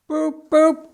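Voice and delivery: Ivor's whistle hooting; engine whistle. Acted. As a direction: sound it out like a steam whistle. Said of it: "went Ivor's whistle".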